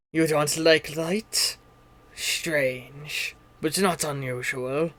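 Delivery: slow, low, softened creature voice; wary game character. Acted. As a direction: ponder slowly and suspiciously. Game master voices a suspicious character.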